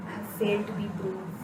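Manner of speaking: breathless defeated whisper. Acted. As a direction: whisper it, all hope gone. Final defeated line.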